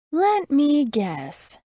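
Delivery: slow, drawn-out sarcastic tease. A narrator's reading. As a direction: stretch it out with dry sarcasm. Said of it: "I say sarcastically".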